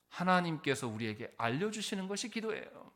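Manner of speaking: ordinary talk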